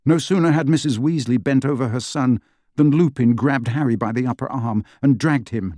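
ordinary delivery, nothing marked

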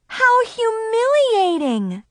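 high, hammed-up cry of embarrassment; dramatic exclamation. A narrator's reading. Narrator mortified at her own party, high dramatic wail. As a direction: wail it like a tragedy.

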